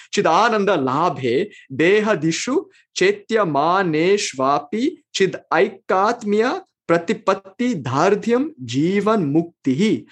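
slow, measured chant-like recitation of the sutra; recited Sanskrit verse. Shown as a demonstration. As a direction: intone the verse slowly, word by word. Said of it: "so let me tell you".

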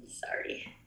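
tearful, barely voiced apology; fighting back tears, softly; choked up whisper. Real, not acted. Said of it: "it's so emotional for me".

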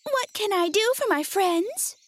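high, tiny mouse-queen voice; small squeaky storybook queen; squeaky little voice. A narrator's reading. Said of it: "in her squeaky little voice".

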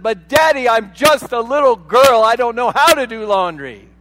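high, loud stomping-foot whine; bossy little princess. Retold or imitated. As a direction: whine like a stubborn little girl. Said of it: "she stomped her foot and she said".

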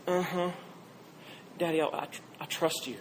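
softly imitating a tearful mumble; hesitant, low and soft, as a lightly voiced little girl. Little girl reassuring her daddy in line, mild tearful mumble. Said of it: "through her tears, she mumbles this".

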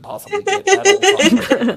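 breaking into laughter; laughing. Real, not acted. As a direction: laugh through the end. Lawyer's blunt honest opinion.

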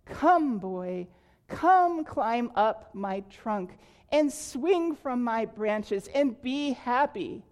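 put-on tree calling the returning boy, overjoyed; joyful calling. A narrator's reading. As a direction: call him in with delight. Tree calls boy with joy.

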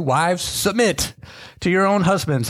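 "submit" drawn out slowly, with a falling stress; slow emphasis. Shown as a demonstration. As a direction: read the verse slowly, leaning on "submit". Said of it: "How fun".

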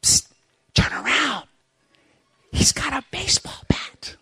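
high, hushed warning whisper; breathless secret tip-off whisper; urgent whisper. Acted. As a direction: hiss a warning to turn around.

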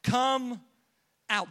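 mild commanding yell, words set apart; retold yell. Preacher imitating Jesus yelling into the cave, retold yell. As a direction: call it out firmly, retelling a command. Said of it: "he yells out".

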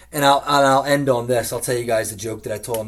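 usual speaking voice

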